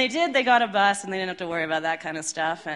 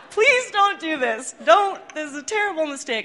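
mock pleading; 9 semitones higher, 5 dB louder than usual. Band begging the bassist not to dive, mock pleading. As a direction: plead with him not to do it, half joking.

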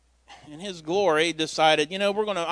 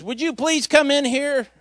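Lakeisha calling him in; 10 semitones higher, 4 dB louder than usual. Retold or imitated. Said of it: "And she goes".

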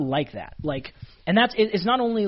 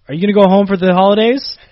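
loud, high imitation of teasing jokesters; hammed-up prankster question, pitched up; mock-innocent teasing; 6 semitones higher, 12 dB louder than usual. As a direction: tease the new guy with a loud, leading question. Pranksters tease the new guy.

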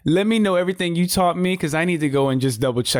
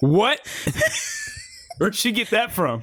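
shocked disbelief; 6 semitones higher than usual. Real, not acted. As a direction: sound stunned and disbelieving. Shocked reaction to the story.

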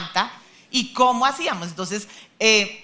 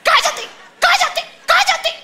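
high, loud mocking snap, laughing; shushing angrily; 9 dB louder than usual. Wife mimicking her stressed husband, loud mocking snap. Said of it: "él empieza a mandar a callar".

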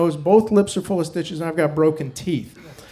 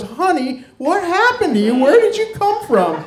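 high, rising alarm, honey what happened; a nurse gasping at the scars, much higher; shocked nurse; 12 semitones higher, 4 dB louder than usual. Retold or imitated. Nurse startled by stab wounds, high shocked voice.

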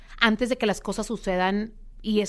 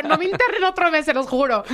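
higher, louder desperate plea; pleading; 6 semitones higher, 4 dB louder than usual. Retold or imitated. Patient begging three doctors not to keep her another month, higher and louder. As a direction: beg them not to lock you in another month.

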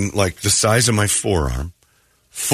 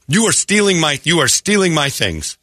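higher voice of an outraged customer; mock indignant accusation; 8 semitones higher than usual. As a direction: imitate her accusing the guard, indignant. Retold woman's indignant accusation.

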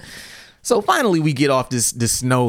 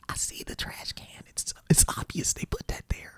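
whispered aside; 13 dB quieter than usual. Real, not acted. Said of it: "aside whispered".

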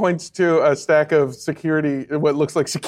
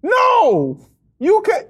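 sharp shout; 5 dB louder, much slower than usual. Acted.

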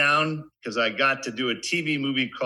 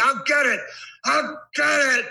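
high, strained frustrated shout; frustrated yell. Retold or imitated. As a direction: yell it at yourself, getting nowhere. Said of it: "this guy was trying to get".

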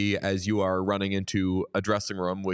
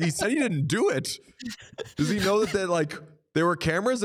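high, incredulous question; can't believe what you just heard; mock astonished repeat, pitched up; retold disbelief; 7 semitones higher than usual. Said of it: "I was like".

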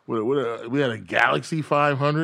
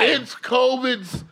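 high, slow put-on voice; mock excuse voice; 11 semitones higher, much slower than usual. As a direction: drawl the excuse mockingly.

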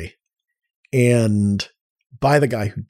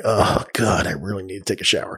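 low, tailing off softer; weary groan; 5 semitones lower, 4 dB quieter than usual. Retold or imitated. Dirty camper craving a shower, imitated weary groan. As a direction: mildly groan it as an imagined complaint.

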